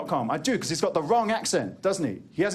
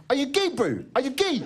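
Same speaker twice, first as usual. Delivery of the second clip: high-pitched mock kid, jeering; needling question, pitched up; cheeky young heckler; 7 semitones higher than usual. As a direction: imitate a pestering youngster. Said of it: "all these young little dicks".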